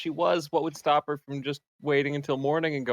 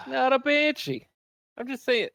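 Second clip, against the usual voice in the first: high exasperated "not a banshee"; sigh, then deny it in exasperation; 10 semitones higher, 5 dB louder than usual. Acted. Exasperated denial, pitch jump.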